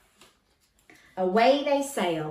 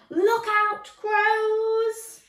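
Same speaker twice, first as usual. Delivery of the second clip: high, loud warning cry; alarmed shout; 13 semitones higher, 5 dB louder, much slower than usual. Acted. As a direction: call out the danger.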